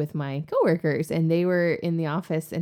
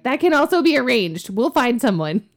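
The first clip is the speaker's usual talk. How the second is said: louder, higher imitation of keen coworkers; eager mock scheming; 7 semitones higher, 6 dB louder than usual. Coworkers offering to find a Southerner, imitated eagerly. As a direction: jump on it eagerly, near laughter.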